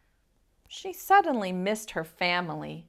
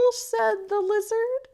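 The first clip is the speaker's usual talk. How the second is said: usual speaking voice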